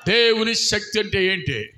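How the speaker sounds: normal talking voice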